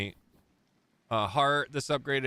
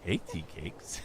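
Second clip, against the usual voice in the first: sleazy pickup line; 8 semitones higher, 5 dB quieter than usual. Retold or imitated. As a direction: murmur it like a creep flirting. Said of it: "the sacrificial lamb for the creep voice".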